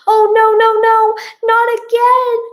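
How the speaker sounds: high, loud acted scream; panicked scream. A narrator's reading. Narrator sees a second winged cat, screaming high. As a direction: scream it in alarm. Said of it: "I scream and pull Joe".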